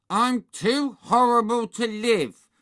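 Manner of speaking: slow, high, loud reluctant recital. A narrator's reading. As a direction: raise the voice on the hated line. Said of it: "a fraction louder".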